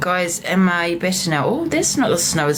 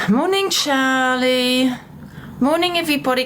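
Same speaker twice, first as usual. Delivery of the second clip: sing-song greeting; 6 semitones higher than usual. Real, not acted. Greeting viewers, drawn out.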